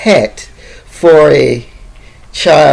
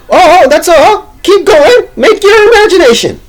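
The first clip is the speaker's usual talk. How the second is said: very high, excited mock voice; hammed-up punchline voice; 13 semitones higher, 4 dB louder than usual. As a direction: squeal out the punchline in a put-on high voice. Acting out the joke's punchline.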